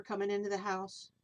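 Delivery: plain reading voice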